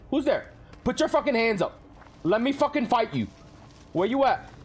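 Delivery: high, rising terrified challenge; shouting false courage into the dark; building from shaky bravado to a panicked yell; scared bravado. Real, not acted. Scared streamer challenging unseen monster.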